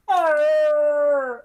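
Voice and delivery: long high wail; drawn-out high-pitched mock cry; mock shouted answer. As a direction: imitate her holler, stretched out. Imitating woman repeating shouted answer.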